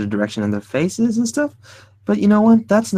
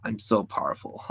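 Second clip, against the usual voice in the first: a lightly voiced smug doctor, softer and lower; cocky doctor; 4 semitones lower, 6 dB quieter than usual. Artist voicing his sketched cocky doctor, lightly smug. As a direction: mutter it like a doctor pleased with himself. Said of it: "this cocky doctor".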